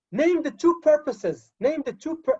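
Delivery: falling command tune on "Name the two purposes"; command intonation. Shown as a demonstration. Teacher demonstrating a command's falling tune. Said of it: "Look at my intonation".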